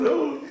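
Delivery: squealing laugh; high laugh. Real, not acted. Laughing burst.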